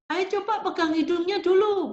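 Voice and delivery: high-pitched, coaxing instruction; playful instruction to child. Shown as a demonstration. Teacher showing parents how to instruct a child before playtime, coaxing and high. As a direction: coax a small child into a little task.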